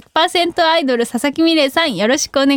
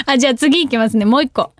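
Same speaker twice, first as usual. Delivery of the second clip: ordinary talk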